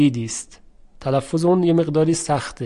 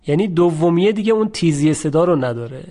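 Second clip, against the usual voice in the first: usual speaking voice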